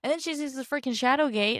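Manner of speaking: ordinary talk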